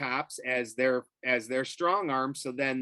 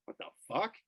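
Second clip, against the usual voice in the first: soft, higher-pitched baffled question; hushed disbelief; 4 semitones higher, 6 dB quieter, much faster than usual. Retold or imitated. Man hearing a blast near his lab, softly baffled. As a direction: re-enact puzzled disbelief under your breath.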